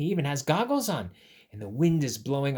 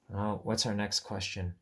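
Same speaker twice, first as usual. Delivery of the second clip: usual speaking voice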